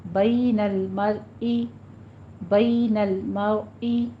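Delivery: بین drawn out very slowly, heavy را, no عی sound; slow recitation demo. Shown as a demonstration. Teacher models for the class, very slow recitation. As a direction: draw out بین المرئی slowly and deliberately. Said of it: "اس کو عی بھی نہیں کہنا ہے".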